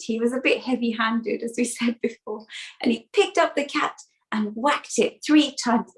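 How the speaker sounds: usual speaking voice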